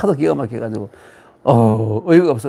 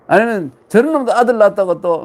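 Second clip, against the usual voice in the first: scornful jibe; 6 semitones higher, 4 dB louder than usual. Acted. Same scornful saying repeated.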